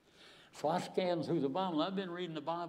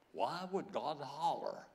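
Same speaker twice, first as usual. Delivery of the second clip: usual speaking voice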